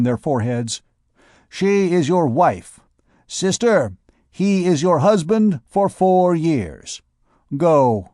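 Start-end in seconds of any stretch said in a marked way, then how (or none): 1.5-8.1 slow, higher ceremonial voice; solemn pronouncement. Acted. Duke of Egypt marrying the pair, slow and solemn. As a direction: pronounce it like a rite.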